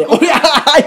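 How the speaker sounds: high, loud charging yell; battle cry. Acted. Acting out his charge with hands outstretched, loud battle cry. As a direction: bellow it high as you rush in. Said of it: "大きい声で".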